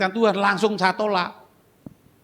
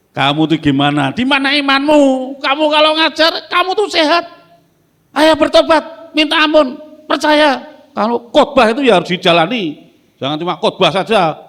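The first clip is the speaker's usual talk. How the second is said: loud, high preacher's rebuke; older preacher scolding a sick colleague, loud and high; 9 semitones higher, 9 dB louder than usual. Retold or imitated. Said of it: "Dimarahi, Om".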